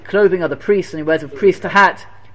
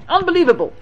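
much higher, marvelling cry; amazed exclamation; 13 semitones higher, faster than usual. Real, not acted. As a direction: burst out in wonder. Preacher marvels at the verse.

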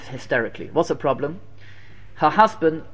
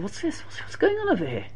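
higher, hushed astonishment; surprised rabbi; 9 semitones higher, 9 dB quieter than usual. Retold or imitated. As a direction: ask it amazed and hushed. Rabbi finds hidden priest clothes.

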